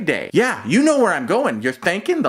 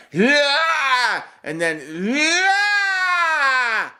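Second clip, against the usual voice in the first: high, drawn-out mock yell; the skinny kid yelling through every rep, imitated high and stretched out; imitating a lifting yell; 9 semitones higher, much slower than usual. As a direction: ham up his yell on each rep. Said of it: "he's yelling at every single one".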